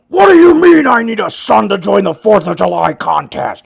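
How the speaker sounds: angry Hulk voice. Pretend. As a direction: bellow it like the furious Hulk toy. Voicing the Hulk figure.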